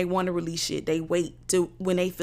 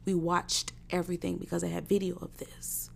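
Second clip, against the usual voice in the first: softer, breathy whisper; 7 dB quieter than usual. Real, not acted. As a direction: drop to a whisper. Whispered community quote.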